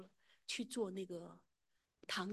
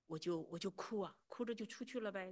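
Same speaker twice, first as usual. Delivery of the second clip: usual speaking voice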